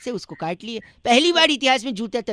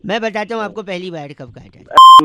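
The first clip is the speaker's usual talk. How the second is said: loud announcement; 4 semitones lower, 12 dB louder than usual. Acted. Prank caller announces shoe history.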